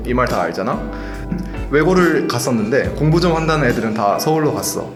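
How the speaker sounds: normal talking voice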